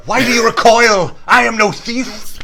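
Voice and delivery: mock Boromir. Quoting Boromir line.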